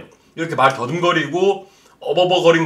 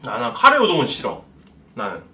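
slurping noodles between words; talking while eating; 2 semitones lower than usual. Real, not acted. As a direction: mouth partly full of noodles. Seen 1: Holds yellow cup with chopsticks, lifts noodles to mouth while speaking.

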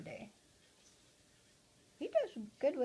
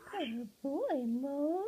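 higher, sing-song praise for the bird; pet talk; 5 semitones higher, 4 dB louder, much slower than usual. Real, not acted. Owner praising her parrot Mose, high cooing pet talk. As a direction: coo it to your parrot.

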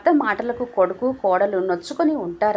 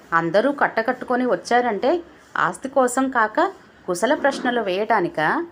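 usual speaking voice

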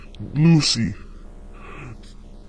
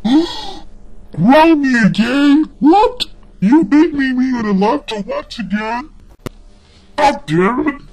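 high, loud furious yelling; outraged shout; 10 semitones higher, 6 dB louder than usual. Acted. Kid shrieking at parent who took laptop, outraged yell. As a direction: scream your outrage at being punished.

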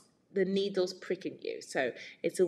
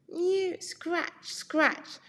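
high mew, then scratching; scuffling cat; 11 semitones higher, much slower than usual. A narrator's reading. Cat scratching at the windowsill to get in. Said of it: "scuffled Simpkin".

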